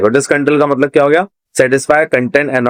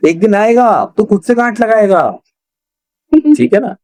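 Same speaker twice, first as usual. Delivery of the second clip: higher, gently coaxing reassurance; consoling a friend; 8 semitones higher than usual. Acted. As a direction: comfort a buddy who can't tie his laces. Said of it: "उसको मना रहा है".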